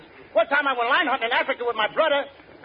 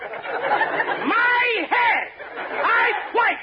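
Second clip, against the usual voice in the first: panicked shout; 8 semitones higher, much slower than usual. Acted. Hit by balls, panics.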